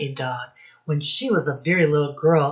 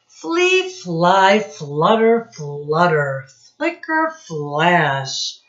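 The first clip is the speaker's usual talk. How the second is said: louder, jumpy pitch leaps on each word; louder, bouncy action words; lively action words; 2 semitones higher, 5 dB louder, slower than usual. Shown as a demonstration. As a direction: make each word fly off for the kids. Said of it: "All sound words".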